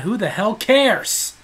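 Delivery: normal talking voice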